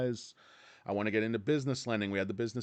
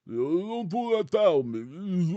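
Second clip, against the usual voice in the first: a loose Rocky-style plea, louder and higher; Sylvester Stallone; 5 semitones higher, 5 dB louder, slower than usual. Retold or imitated. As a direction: play a bad boxer impression begging his corner. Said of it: "my Sylvester Stallone impression".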